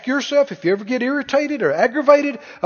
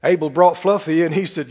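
ordinary talk, nothing marked

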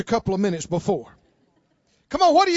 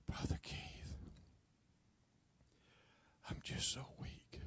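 barely audible, weak whisper; faint whisper; 12 semitones higher, 26 dB quieter, faster than usual. Retold or imitated. Sick missionary whispers to Brother Keith, very faint. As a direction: whisper like a dying, exhausted woman. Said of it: "I couldn't hear her".

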